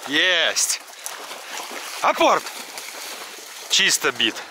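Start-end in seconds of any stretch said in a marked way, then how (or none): 2.0-2.4 sharp high command to dog. Real, not acted. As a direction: call the dog to fetch. Hunter commanding retriever dog.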